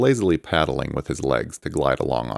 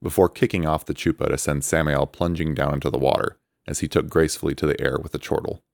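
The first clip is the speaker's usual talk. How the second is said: ordinary delivery, nothing marked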